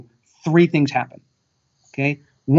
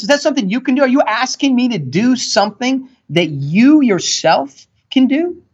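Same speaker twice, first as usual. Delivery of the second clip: imagined angry retort; 8 semitones higher than usual. Retold or imitated. Retelling the angry comeback he only thought. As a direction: snap back in mock outrage at the pitch-rusher. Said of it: "And I just got mad".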